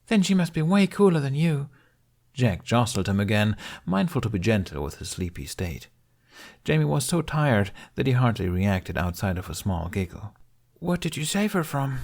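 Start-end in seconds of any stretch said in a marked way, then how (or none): 0.1-1.7 put-on high, playful kid voice; a small boy ribbing his friend; voiced young boy; teasing little boy. Child teasing older boy. 10.8-12.0 put-on high, curious kid voice; a child asking gently; voiced young boy; curious little boy. Child character voice.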